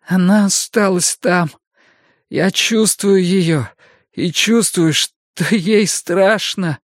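put-on high, frantic young man pleading; desperate youth begging for help; Mikael panicking.

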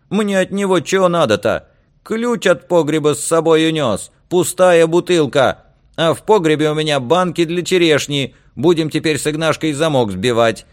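high, loud complaining woman; high-pitched, scornful grievance about a husband; brash fishwife, indignant. Acted. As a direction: harangue the men from the doorway. Woman grumbles about absent husband.

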